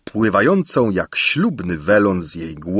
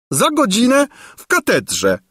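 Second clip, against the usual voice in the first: put-on much higher, swooning old woman echoing "godzinę w katedrze"; swooning sigh. A narrator's reading. Gburia Furia swooning over the king's words, high sighing voice. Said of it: "westchnęła Gburia Furia omdlewającym głosem".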